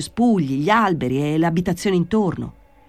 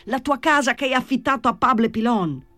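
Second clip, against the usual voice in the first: mildly raised, urgent voice; shouted explanation; 7 semitones higher than usual. A narrator's reading. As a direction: call the explanation up to a window. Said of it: "gridò Gesù Maria".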